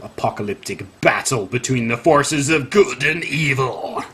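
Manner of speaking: hammed-up epic voice, slowing to the end; grandiose, drawn-out trailer-style delivery; mock apocalyptic. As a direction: make it sound like the final battle. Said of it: "dramatically written article".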